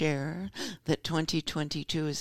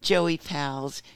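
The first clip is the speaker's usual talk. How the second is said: ordinary talk, nothing marked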